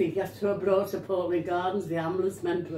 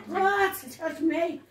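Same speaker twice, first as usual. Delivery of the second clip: higher, louder, scornful comeback; indignant retort; 8 semitones higher, 4 dB louder than usual. Retold or imitated. The wife scornfully rebuffing her husband's advances, mock outrage. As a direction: fire it back at him in mock outrage.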